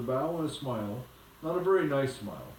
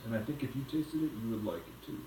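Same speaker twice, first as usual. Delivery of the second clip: quieter, fast, confiding; soft aside; 4 semitones lower, 6 dB quieter, faster than usual. Real, not acted. Aside to listening child.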